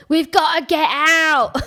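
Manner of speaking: high, loud, drawn-out fake Cockney; terrible Cockney accent. Podcaster mocking the actor in the action, terrible Cockney accent. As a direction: overdo a bad Cockney yell, stretching the words. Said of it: "he does this terrible accent".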